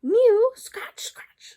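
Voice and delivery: unvoiced whispered mew; breathy whispered cat noises; whispered cat scratching. A narrator's reading. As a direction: hiss it like a cat scrabbling at glass. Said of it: "scuffled Simpkins on the windowsill".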